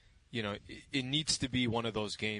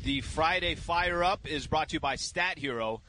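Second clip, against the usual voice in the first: slow, deliberate sponsor read; measured radio sponsor plug; 3 semitones higher than usual. Acted. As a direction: read the ad copy like a host. Reads sponsor ad.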